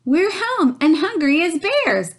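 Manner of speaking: high, loud bear family voices; announcing bears. A narrator's reading. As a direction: announce it proudly as the three bears. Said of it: "announced the three bears".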